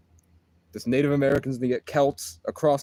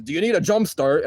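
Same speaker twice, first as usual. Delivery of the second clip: louder, higher, light Scottish impression; imitated Scottish accent; 5 semitones higher, 5 dB louder than usual. Giant stranger offering the narrator help, imitated Scottish accent. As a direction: ask it in a mock Scottish accent. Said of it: "a deep Scottish accent".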